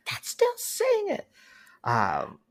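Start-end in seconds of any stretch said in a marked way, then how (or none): 0.1-1.3 very high, slow, drawn-out teasing; gotcha voice. Retold or imitated. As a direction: stretch it out in a squeaky mocking tone. Repeats gotcha line.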